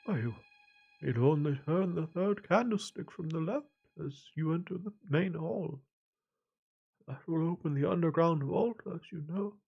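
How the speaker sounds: put-on a confused old man, drifting; frail old man.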